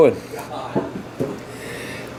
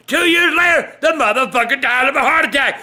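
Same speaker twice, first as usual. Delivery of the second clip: normal talking voice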